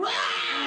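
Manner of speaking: high mock bawl; imitated baby wail. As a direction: wail like a crying toddler, hammed up. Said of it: "the kid starts crying".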